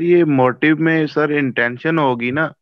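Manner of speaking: ordinary talk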